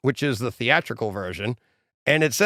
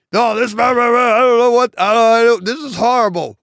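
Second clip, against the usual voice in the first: high, loud, sputtering mock panic; frantic Carl; 8 semitones higher, 8 dB louder than usual. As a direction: gush and stammer like an overexcited fan. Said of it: "he's like".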